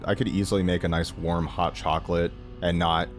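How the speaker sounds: ordinary talk, nothing marked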